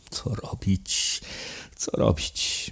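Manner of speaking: whispered, slow and despairing; desperate whisper. A narrator's reading. Dyzma alone at night over the ledgers, whispering in despair. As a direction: breathe it out at a loss.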